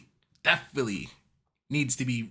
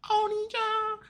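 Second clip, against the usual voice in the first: mock anime voice; 4 dB quieter, slower than usual. Mocking anime term.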